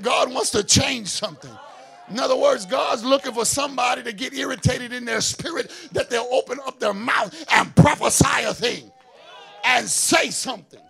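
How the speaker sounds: loud, declaring pulpit shout; full-voiced gospel proclamation; preaching shout. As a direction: proclaim it to the whole room. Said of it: "I said".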